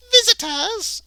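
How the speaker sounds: very high, loud Irish maid exclamation. Acted. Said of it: "And the maid's to be Irish".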